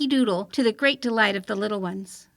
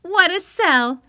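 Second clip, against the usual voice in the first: mocking child; 5 semitones higher, 5 dB louder, slower than usual. April Fool mocks aunt.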